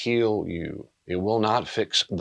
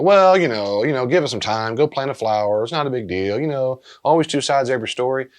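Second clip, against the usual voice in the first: louder, higher mock brush-off; dismissive friend; 5 semitones higher, 7 dB louder than usual. Counselor imitating someone trying to fix grief, loud and dismissive. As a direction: imitate a friend glibly minimizing it, loudly.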